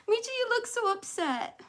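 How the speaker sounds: high soft pet voice. Real, not acted. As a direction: coo at the pet. Talking to the dog.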